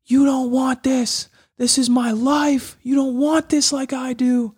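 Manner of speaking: high, slow, shouted at the opponent; retold yelling. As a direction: yell it down at him, slow and fierce. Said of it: "I start yelling at him".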